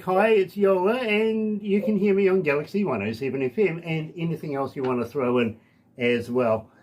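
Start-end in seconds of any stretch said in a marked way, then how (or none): 0.0-3.8 higher-pitched mock radio ident; lightly acted artist's radio drop; sample voice drop. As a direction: model a friendly station greeting for the guest. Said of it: "say something like".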